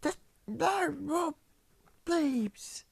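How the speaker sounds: high, slow falsetto plea; drawn-out squealed begging; begging for mercy. Acted. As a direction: plead desperately in a squeaky high voice. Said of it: "begging for mercy".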